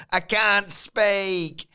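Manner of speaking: strained, hoarse croak with the voice giving out; croaky lost voice. Shown as a demonstration. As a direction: squeeze the words out past a dead voice. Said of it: "my voice".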